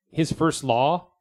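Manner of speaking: usual speaking voice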